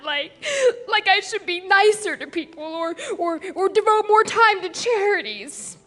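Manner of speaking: tearful, halting confession; weepy, searching for words; crying. Acted. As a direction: choke out the words through sobs. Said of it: "are you crying?".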